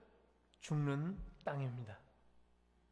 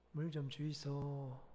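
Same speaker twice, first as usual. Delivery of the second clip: slow, lightly voiced Gyeongsang accent on "물 좀 주이소"; a mild Gyeongsang dialect imitation, slowly; 3 semitones lower, 5 dB quieter, slower than usual. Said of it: "갱상도 말로".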